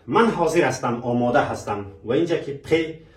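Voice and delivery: ordinary talk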